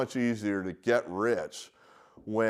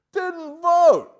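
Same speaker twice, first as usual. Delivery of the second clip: "Didn't vote" shouted much higher and louder; outraged shout; 11 dB louder than usual. Real, not acted. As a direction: yell it in disbelief. Repeats didn't vote angrily.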